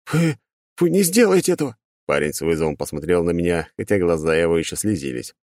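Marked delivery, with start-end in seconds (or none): 0.1-1.8 scared defiance. Acted. Boy defies threat.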